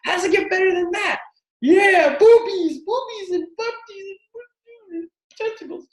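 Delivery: very high, giddy put-on voice; mock excited squeal. Revelling in being judged, hammed-up high squeal.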